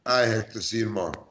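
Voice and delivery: cold curt reading. Shown as a demonstration. Friend reading the same text, sounding miffed. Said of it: "You know the difference".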